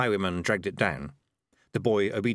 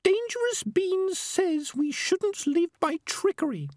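very high, slow little squeak; tiny high creature voice; squeaky voice; slower than usual. A narrator's reading. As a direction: squeak it out slowly and carefully. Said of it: "said the squeaky voice".

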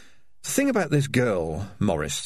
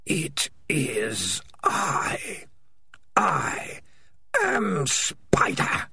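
slow, drawn-out whispering voice like wind in far trees; eerie distant spider voice; 4 semitones higher, much slower than usual. Acted. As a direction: let each word creep out slowly and eerily. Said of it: "like the sound of wind in distant trees".

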